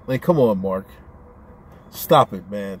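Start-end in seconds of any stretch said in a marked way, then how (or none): none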